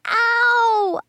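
put-on very high child's ows; pained yell. A narrator's reading. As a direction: yelp in exaggerated pain. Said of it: "I yelled again".

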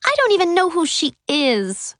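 high, whiny complaint; whiny girl.

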